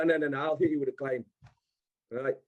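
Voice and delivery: usual speaking voice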